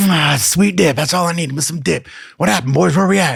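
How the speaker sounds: high, hammed-up relieved voice; revived buddy. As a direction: come to, blissful over the dip, imitating.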